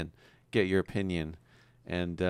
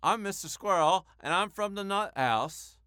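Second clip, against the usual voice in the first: squirrel voice; 10 semitones higher than usual. Shown as a demonstration. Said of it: "perform our voices".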